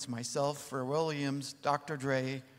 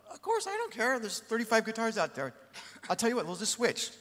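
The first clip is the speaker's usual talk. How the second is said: much higher, lightly voiced easygoing [name]; retelling [name]'s offer in a higher put-on voice; imitating [name]; 8 semitones higher than usual. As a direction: toss it off like a relaxed rock star, pitched high. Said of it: "He goes".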